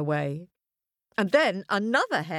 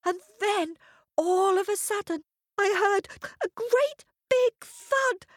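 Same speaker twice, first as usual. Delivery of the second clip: much higher, stuttering little hare; nervous stammer; 12 semitones higher than usual. A narrator's reading. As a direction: stumble over words, scared of the lion. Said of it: "The little hare was very nervous".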